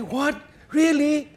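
high, incredulous what really; mock astonishment from the other husbands; surprised disbelief. Husbands react to the joke.

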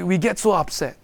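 ordinary talk

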